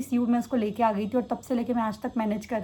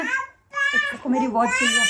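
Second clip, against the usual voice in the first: put-on much higher, slow, babyish voice for the child; voicing the baby; 12 semitones higher, much slower than usual. Mother speaking for her toddler to viewers, high slow baby voice. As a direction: speak for the little one in a tiny high voice.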